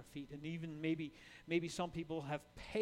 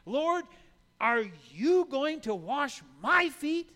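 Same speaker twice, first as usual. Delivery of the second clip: high, loud and slow, aghast; stunned protest, dragging each word; shocked disbelief; 7 semitones higher, 14 dB louder, slower than usual. Retold or imitated. Peter aghast as Jesus kneels with the basin. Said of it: "the shock that Peter feels".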